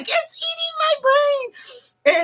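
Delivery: very high, drawn-out mock shriek; comic high-pitched horror; retold freaking out. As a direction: wail it slowly in hammed-up panic. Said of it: "'cause I'm freaking out".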